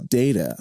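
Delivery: "Data" said DAY-tuh; Star Trek pronunciation. Shown as a demonstration. Guest explaining his spelling, "Data" as DAY-tuh. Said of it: "as the Star Trek character would".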